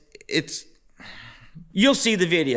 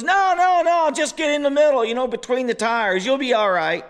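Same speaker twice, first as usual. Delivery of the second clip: buddy reassuring; 6 semitones higher than usual. Retold or imitated. Buddy waving off my worry about getting soaked, higher and insistent. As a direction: brush off the worry, pitched up and pushy. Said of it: "And he goes".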